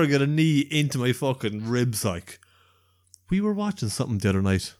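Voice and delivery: ordinary delivery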